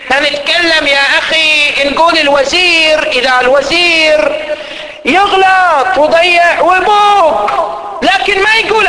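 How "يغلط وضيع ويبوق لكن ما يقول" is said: indignant cry. Acted. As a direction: call it out with slow indignation. Preacher railing against ministers.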